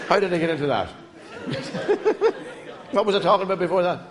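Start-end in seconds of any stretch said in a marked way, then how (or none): 0.9-2.9 high breathless wheeze of laughter; cracking up at his own joke; wheezing laugh. Real, not acted. Comedian wheezes with laughter at his own story.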